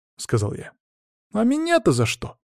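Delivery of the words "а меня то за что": put-on high-pitched protesting girl; loud objection. A narrator's reading. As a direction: object at once, pitched well up. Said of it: "тут же громко возразила Анжела". (громкое возмущение высоким голосом)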